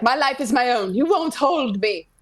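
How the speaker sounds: loud, high theatrical proclamation; defiant declaration. Acted. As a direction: ham it up, drawing out the end. Continues the defiant quotation.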